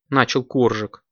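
ordinary delivery

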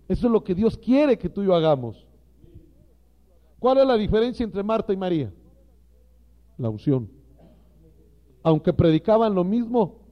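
loud, emphatic pulpit preaching; fiery sermon voice, hammering each phrase; preaching at full force. Acted. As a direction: drive the point home to the ministers.

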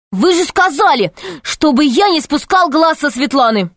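high, aggrieved-sounding protest; desperate boy protesting. A narrator's reading. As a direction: argue back in a high, urgent pitch. Desperate boy protesting to angry man. (мальчишка отчаянно возражает, высоко)

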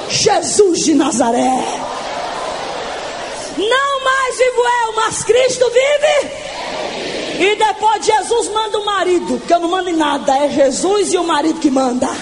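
ordinary delivery

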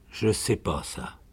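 soft, subdued reply; lost woman. A narrator's reading. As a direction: answer quietly, out of your depth. Lost woman answers the shouting sergeant. (réponse basse de femme perdue)

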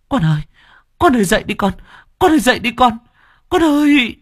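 rising from urgent to a high loud cry; frantic pleading. Retold or imitated. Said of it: "cuống cuồng chạy tới lay con".

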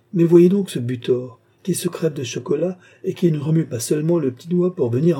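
loud, high-pitched indignant outburst; loud, animated exasperation; exasperated. Acted. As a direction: rail at the useless lout. Countess rails at her idle brother.

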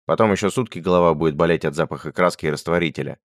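ordinary delivery

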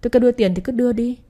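fast, sharp demand for money; impatient husband snapping at his wife; angry demand. Acted. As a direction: bark it out quickly, fed up.

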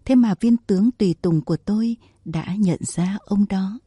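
plain reading voice

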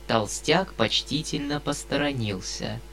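ordinary delivery

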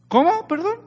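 high, startled mock "what?"; mock surprise. The preacher feigning shock at the congregation's answer, high-pitched.

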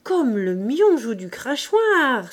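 loud, drawn-out cry in character; exclamation. A narrator's reading. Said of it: "s'écria Babet".

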